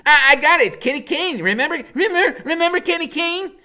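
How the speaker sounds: loud, high laughing shout; bursting with discovery; excited realization. A narrator's reading. Loretta thrilled to recognize Kenny, loud and high. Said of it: "Loretta jumps up".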